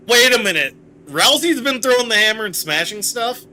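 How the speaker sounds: high, loud incredulous question; sudden realization. Real, not acted. Player realizes what Ralsei has been doing, loudly incredulous. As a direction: blurt it out as the penny drops.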